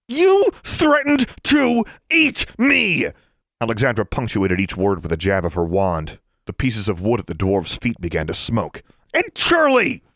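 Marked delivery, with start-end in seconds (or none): 0.1-3.1 high, slow, every word punched; high slow furious shout. A narrator's reading. Furious jabs per word. 9.1-10.0 much higher angry cry; angry shout. A narrator's reading. Furious outburst.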